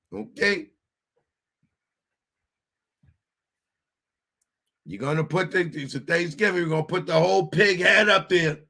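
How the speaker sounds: lightly put-on wiseguy sincerity; hammed-up mafia warning; mock mobster. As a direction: play a paisan swearing he's trustworthy. Mobster vouches for himself.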